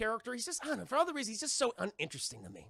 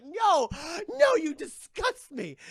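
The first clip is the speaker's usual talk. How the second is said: much higher, much louder, slow mock recoil; disgusted Ivy; 9 semitones higher, 9 dB louder, much slower than usual. Pamela rejecting Woodrue, loud hammed disgust. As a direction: spit it out in revulsion. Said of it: "she's like".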